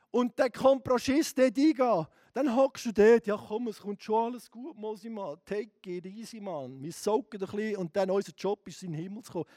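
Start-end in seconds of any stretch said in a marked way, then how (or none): 3.3-9.5 soft, lazy take-it-easy voice; mock complacent believer, much softer; laid-back brush-off. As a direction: shrug it off, half-asleep.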